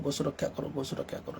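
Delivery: very soft, half-voiced imitation of others; murmured quote. Speaker quoting the cow-protection talk going round, murmured. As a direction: quote it under your breath, almost whispering.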